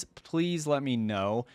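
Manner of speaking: usual speaking voice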